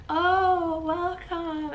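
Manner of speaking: high, airy mock-serene welcome; calm greeting. Hospital staff greet woman in labour, exaggeratedly calm. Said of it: "Why are you so calm?".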